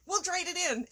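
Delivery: hammed-up car lot ad voice; used car salesman. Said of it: "I sound like a used car salesman".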